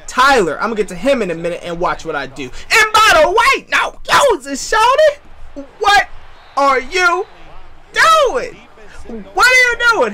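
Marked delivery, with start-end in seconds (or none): 2.4-10.1 high-pitched, drawn-out taunting squawk; high comic voice of disbelief; mock disbelief. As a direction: squeal it in exaggerated outrage, stretching the words. Mock outraged catchphrase at opponent.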